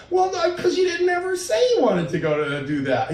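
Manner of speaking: high-pitched self-justifying protest; defensive retort. Acted. Partner answering a complaint, defensively. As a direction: imitate a partner on the back foot, voice way up.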